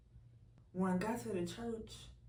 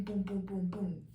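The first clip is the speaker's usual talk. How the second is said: ordinary talk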